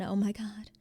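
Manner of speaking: breathy whispered despair. Retold or imitated. Sitting alone after crying, whispered despair. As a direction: whisper it, defeated.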